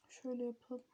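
whispering. Real, not acted. Quiet aside to viewers.

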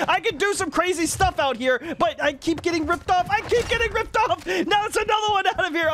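high-pitched, exasperated gamer whine; agitated and pitched well up; worked-up complaint. Real, not acted. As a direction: protest with pitch climbing. Complaining of being ripped off.

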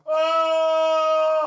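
high, loud wailing Oh; mock wailing cry. Preacher voicing the possessed man's howl, loud wail. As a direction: howl it out like a tormented man. Preacher acts demon crying on mountain.

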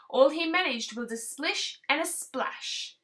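plain reading voice

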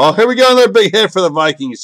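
real burst of live excitement; excited hit call. Real, not acted. Card breaker spots a big hit for the Vikings, excited.

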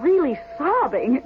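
ordinary talk

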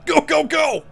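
a soldier yelling at his squad to hurry; frantic shouting. Retold or imitated. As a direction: bark the orders like a panicked officer. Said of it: "He's like".

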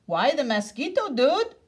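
high eager puppy voice; squeaky little sidekick; small excited dog. A narrator's reading. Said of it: "asked Paquito Tito".

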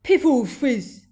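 high, slow, very loud giant shout; shouted scorn. A narrator's reading. Blood Bottler dismissing the BFG's answer, scornful shout. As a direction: shout it slowly as a giant's oath. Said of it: "shouted the Blood Bottler".

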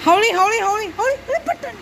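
high urgent repeated call. Real, not acted. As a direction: shout it up high to the driver. Calling to the driver, slow down.

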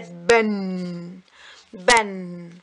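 "بن" said as ban with a long held N; slow "بن", humming out the final N; fathatan tanween. Shown as a demonstration. Teacher showing doubled fatha on baa, drawn-out ban. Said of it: "أخدت جنبها كمان فتحة".

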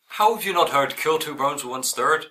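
ordinary delivery

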